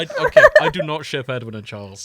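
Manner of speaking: loud, insistent protest against the tease; emphatic denial. Real, not acted. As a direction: push back hard, half laughing. Denying a shipping tease.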